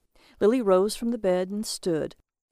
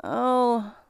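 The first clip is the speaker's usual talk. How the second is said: disappointed little "oh", pitched up; 4 semitones higher than usual. A narrator's reading. Lily seeing her husband's screen, disappointed. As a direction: let down, with mild dismay. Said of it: "she said, disappointed".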